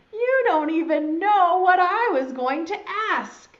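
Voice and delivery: high, loud caterpillar whine; indignant protest. Acted. Caterpillar objects to friend's "not yet", indignant. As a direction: protest loudly at being cut off.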